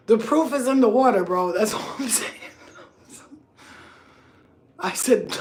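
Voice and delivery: normal talking voice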